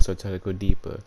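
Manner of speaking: ordinary delivery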